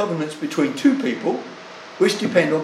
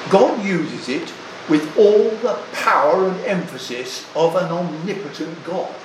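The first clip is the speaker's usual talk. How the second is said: normal talking voice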